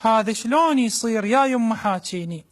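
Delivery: ordinary talk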